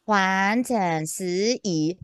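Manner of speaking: very slow Taiwanese-accented Mandarin. Shown as a demonstration. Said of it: "因为台湾国语".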